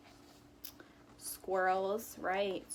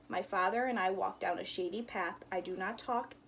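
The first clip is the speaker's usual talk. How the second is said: normal talking voice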